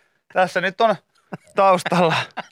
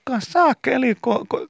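squeaky aphid voice. Retold or imitated. Aphid voice in ear, mock.

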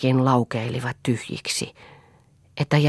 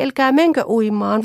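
higher, loud pleading, menkö stretched; more desperate plea; 8 semitones higher, 4 dB louder than usual. Acted. Children begging mother again, more desperately. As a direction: beg harder this time. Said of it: "hätäillen huusivat".

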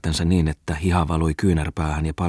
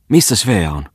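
louder, higher urgent question; urgent demand; 4 semitones higher, 6 dB louder than usual. A narrator's reading. Wife demanding to know where Svea is, exclaiming. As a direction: call it out sharply.

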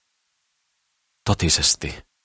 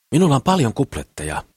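higher-pitched, lively boastful suitor; eager Kukkelman; 9 semitones higher, 4 dB louder than usual. A narrator's reading. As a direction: coax them out with big promises. (innokkaasti houkutellen, korkeammalla äänellä)

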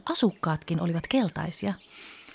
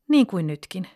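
ordinary delivery, nothing marked

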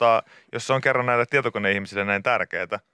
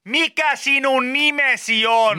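high, slow, loud over-articulated question; mock shouted question; 12 semitones higher, 4 dB louder, slower than usual. Host mocks shouting at aliens, loud and slow. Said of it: "sitten vaan huudetaan".

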